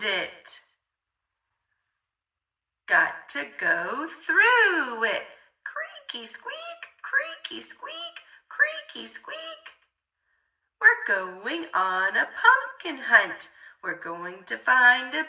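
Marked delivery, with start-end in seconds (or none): 5.6-9.6 very high, soft whispered squeak; creaky whisper. A narrator's reading. Narrator making the house creak: high whispered squeak. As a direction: squeak it like an old creaking door.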